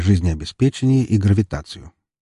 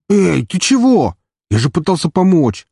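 indignant yelp; 9 semitones higher, 4 dB louder than usual. Acted. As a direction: cry out offended and stunned. Hit hacker protests.

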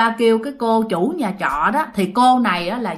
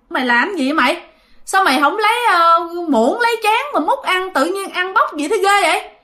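giọng cao gắt trách em; chị la em, giọng vút cao; la mắng; 7 semitones higher than usual. Retold or imitated. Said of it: "Bạn mới la". (la mắng em gái)

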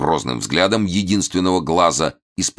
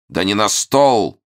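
loud, high sharp rebuke; shouting; 7 semitones higher, 4 dB louder than usual. Acted. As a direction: snap at the peasants. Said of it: "прикрикнул он на мужиков".

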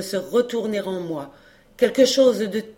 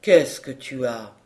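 slow, deep-pitched murmur-like question; low confidential question; 6 semitones lower, 3 dB louder than usual. Acted. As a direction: ask it low and slowly, just to him. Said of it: "me dit-il tout bas". (question posée tout bas, lentement)

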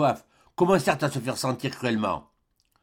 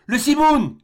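high, loud alarm call; cried out; 12 semitones higher, 6 dB louder, slower than usual. A narrator's reading. Doctor cries out as the desert storm rises, loud cry. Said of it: "s'écria-t-il".

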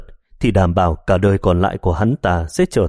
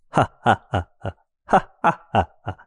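loud mocking laughter. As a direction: laugh him down, long and cruel. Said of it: "cười to một tràng".

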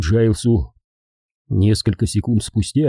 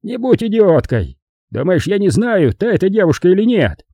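raised, impatient man's retort; irritated and high-pitched; pained exasperation; 9 semitones higher, 4 dB louder than usual. A narrator's reading. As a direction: snap back in a higher voice. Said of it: "поморщился Джайлс".

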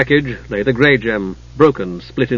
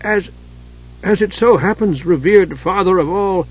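nervous dwarf; 6 semitones higher than usual. A narrator's reading. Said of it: "he stammered on".

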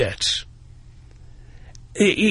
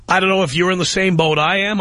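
usual speaking voice

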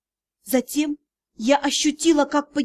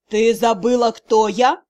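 low, louder threatening male voice; menacing; 4 semitones lower, 5 dB louder than usual. A narrator's reading. Magistr threatening the pinned heroine, louder and low. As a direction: loom over her with the question.

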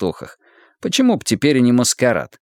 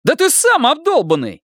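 angry accusation. Acted. Ilya accuses officer.

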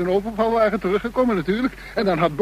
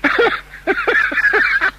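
high, loud burst of wild laughter; maniacal laugh; 4 dB louder than usual. Acted. Bor hearing the news, laughing wildly high. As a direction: cackle out in disbelief. Hears Paul is with Tera.

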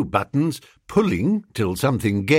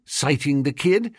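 usual speaking voice

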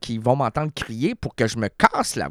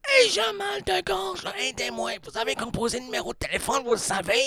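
high strained broken voice; cracked voice; 12 semitones higher, 3 dB quieter, faster than usual. Retold or imitated. Receptionist with a sore throat pleading, cracked. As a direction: croak it out in a high squeak. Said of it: "la voix était cassée".

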